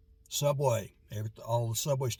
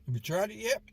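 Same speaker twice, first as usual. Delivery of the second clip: mouth full; 9 semitones higher than usual. Real, not acted. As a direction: still chewing while asking. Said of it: "talking with my mouth full".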